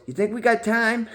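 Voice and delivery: high, laughing punchline; high giggling priest voice; sly priest. Acted. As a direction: deliver it as a leering joke. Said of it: "the priest goes".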